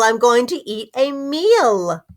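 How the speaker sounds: ordinary talk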